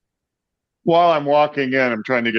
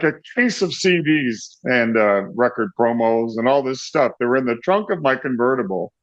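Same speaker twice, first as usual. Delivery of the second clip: usual speaking voice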